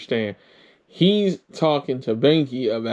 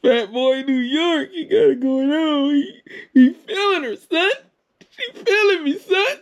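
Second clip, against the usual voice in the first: laughing mockery; 14 semitones higher than usual. Real, not acted. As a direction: crack up while teasing in his slang. Host mocks the friend's story.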